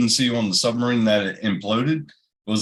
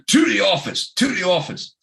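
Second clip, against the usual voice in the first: high-pitched mock teacher ordering pupils out; high mock-stern dismissal; retold teacher's order; 9 semitones higher than usual. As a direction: imitate a scandalised teacher, pitched way up.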